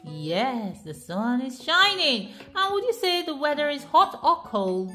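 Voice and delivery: ordinary delivery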